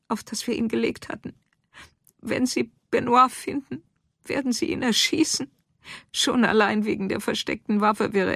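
high, agitated voice of a shaken wife; distraught account. Acted. Farm wife telling Lucille of the shooting, distraught. Wife recounts flight, fears execution.

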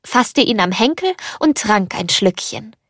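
ordinary delivery, nothing marked

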